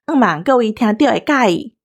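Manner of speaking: normal talking voice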